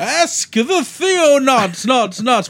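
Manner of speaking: high, slow announcer echo; echo effect. Pretend. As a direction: stretch it out like a show stinger. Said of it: "We really need a stinger for that".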